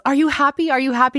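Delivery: high, pleading repeat of the question; imitating an anxious fiancée; eager questioning. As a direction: ask it eagerly, hoping for yes. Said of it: "she goes".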